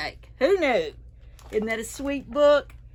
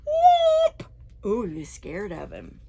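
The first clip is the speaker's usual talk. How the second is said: plain reading voice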